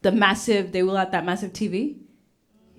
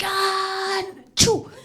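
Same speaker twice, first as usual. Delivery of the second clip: high exaggerated mock sneeze; 9 semitones higher than usual.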